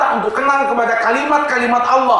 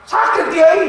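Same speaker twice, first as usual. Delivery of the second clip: high, loud whimpering cry of hurt; pained cry; 12 semitones higher, 4 dB louder, slower than usual. Retold or imitated. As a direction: wail it like a smacked boy. Boy cries out after being hit.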